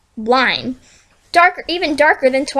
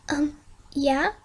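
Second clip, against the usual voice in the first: nervous quiet; 8 dB quieter than usual. Acted.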